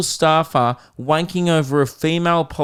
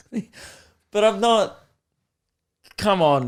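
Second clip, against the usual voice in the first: ordinary talk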